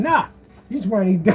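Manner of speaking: ordinary talk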